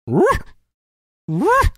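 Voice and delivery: high, loud woofing laugh; mom's barking laugh. Retold or imitated. Mom laughing at him from the living room, high barking woofs. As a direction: bark out a laugh like a dog. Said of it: "'cause when she starts laughing really hard".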